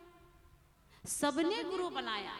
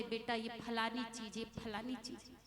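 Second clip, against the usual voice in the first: put-on softer and lower, gently explaining to a small child; loving father; 3 semitones lower, 8 dB quieter than usual. Father patiently answering his curious little son, soft and tender. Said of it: "तो पापा बड़े प्यार से बोलते थे".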